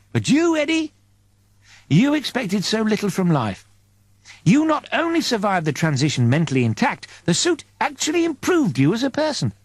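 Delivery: an angel fondly explaining the crew; angel's voice. Acted. Angel telling Eddie why he was chosen, lightly voiced.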